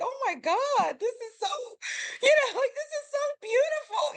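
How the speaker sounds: high-pitched weepy gushing, half laughing; hammed-up happy sobbing; mock crying. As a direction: gush tearfully in a squeaky pitch. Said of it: "like, crying".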